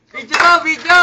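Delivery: loud excited call. Real, not acted. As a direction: call them over to the video. Calling others to the camera.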